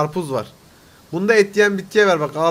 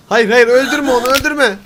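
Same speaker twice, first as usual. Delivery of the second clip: loud, higher no-no-no plea; loud pleading; 4 semitones higher, 7 dB louder than usual. Real, not acted. Pleading with the game character not to kill him, loud. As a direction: beg the screen to stop it.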